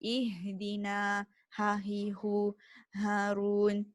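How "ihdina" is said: Ihdina said slowly from the deep throat; Arabic letter demo. Shown as a demonstration. Teacher repeating it slowly for students, careful Ihdina. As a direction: stretch Ihdina out from low in the throat. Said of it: "teacher demonstrates deep throat".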